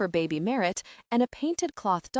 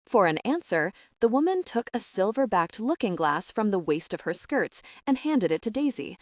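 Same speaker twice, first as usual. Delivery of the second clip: plain reading voice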